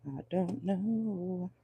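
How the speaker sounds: slow, soft, drawn-out; trailing murmur. Real, not acted. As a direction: let it fade, unsure. Unsure musing to herself.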